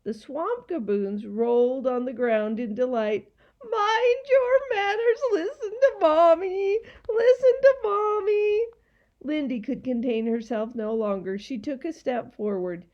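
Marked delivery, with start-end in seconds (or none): 3.6-8.6 high, loud jeering mimicry; mocking creatures. A narrator's reading. Gaboons rolling in delight, mocking the bird. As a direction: parrot the scolding in a shrill singsong.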